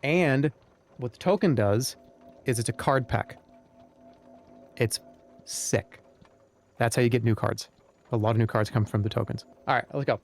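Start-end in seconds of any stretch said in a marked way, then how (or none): none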